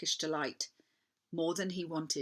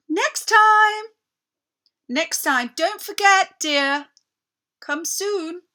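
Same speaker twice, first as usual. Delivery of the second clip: much higher, much louder call into the distance; 12 semitones higher, 13 dB louder, much slower than usual. A narrator's reading. Queen calling back to Edmund from the sled, loud. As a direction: call after him as the sled drives off. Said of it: "calling out".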